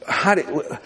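usual speaking voice

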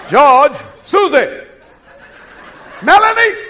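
lightly voiced God calling someone; rising, drawn-up call of a name. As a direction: summon each person by name.

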